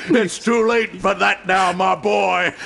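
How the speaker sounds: a pastor telling his captive boy it is too late, mocking; cold gloating. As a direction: savor the boy's doom. Pastor denies protection.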